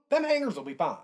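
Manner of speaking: ordinary talk, nothing marked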